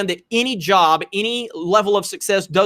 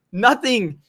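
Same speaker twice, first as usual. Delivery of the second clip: usual speaking voice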